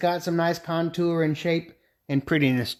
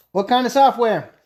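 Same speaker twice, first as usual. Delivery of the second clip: raised, higher-pitched question; yelling at phone; 7 semitones higher, 5 dB louder than usual. Real, not acted. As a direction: call it loudly into the phone. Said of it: "I'm yelling at the phone".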